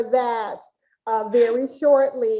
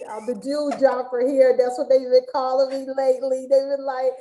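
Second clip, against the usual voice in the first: ordinary delivery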